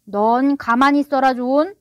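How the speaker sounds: loud, irritated father; flaring up. A narrator's reading. Father silencing his nagging son, flaring up. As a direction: rebuke with a quick flash of temper. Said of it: "달링 씨가 발끈했다".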